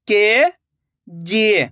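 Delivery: KG drawn out slowly, syllable by syllable; slow syllable split. Shown as a demonstration. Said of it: "मैं किस तरीके से".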